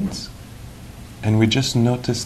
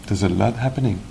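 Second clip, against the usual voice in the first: normal talking voice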